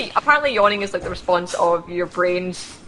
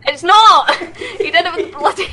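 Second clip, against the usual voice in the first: laughing; 10 semitones higher than usual. Real, not acted. Laughing complaint about being blinded.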